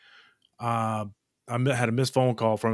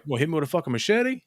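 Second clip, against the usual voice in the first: fast worked-up rant; 6 semitones higher, much faster than usual. Real, not acted. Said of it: "rant continues".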